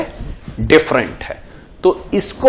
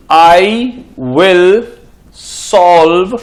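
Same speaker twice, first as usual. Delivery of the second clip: slow dictation; much slower than usual. Shown as a demonstration. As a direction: read out slowly, "dash" for the blank. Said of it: "मैं dash क्यों बोल रहा हूं". (धीमे-धीमे बोलकर वाक्य लिखवाना)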